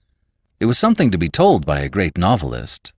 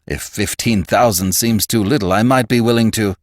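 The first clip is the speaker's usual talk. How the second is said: louder, pitched-up coaxing offer; cajoling; 5 semitones higher, 5 dB louder than usual. A narrator's reading. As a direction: coax him with more money. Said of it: "This was said almost cajolingly".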